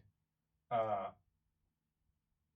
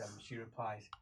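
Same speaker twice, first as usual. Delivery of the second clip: normal talking voice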